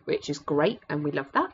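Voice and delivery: ordinary delivery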